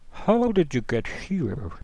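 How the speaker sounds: high and slow, each word drawn out; slow watery question. Acted. Creature asking the hero how he arrived, slow and curious. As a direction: ask gently and slowly, wondering. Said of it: "he kinda looks at you and goes".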